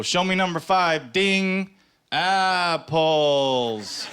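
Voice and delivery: high, drawn-out host call; calling the answer on Family Feud; game-show style. Retold or imitated. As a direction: stretch apples like a host reveal. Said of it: "makes you say things in a way".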